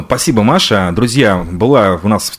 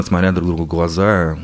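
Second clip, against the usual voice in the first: usual speaking voice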